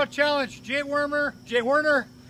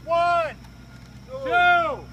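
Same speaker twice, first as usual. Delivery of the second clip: building countdown; 4 semitones higher, 8 dB louder, much slower than usual. Real, not acted. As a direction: count up, louder each number. Countdown building to bucket dump.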